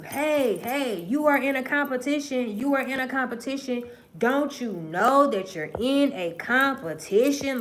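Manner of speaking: high, loud mock wake-up call. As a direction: shout it like tapping someone awake. Imagined someone tapping, calling out.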